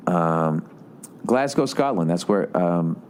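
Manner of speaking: ordinary talk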